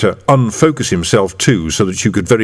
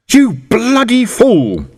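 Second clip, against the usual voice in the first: angry; 13 semitones higher, much slower than usual. Acted. Arrested man protesting furiously.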